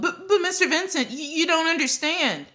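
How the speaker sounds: secretary running after the boss, flustered calling. A narrator's reading. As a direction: chase after him, flustered and pleading. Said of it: "She called".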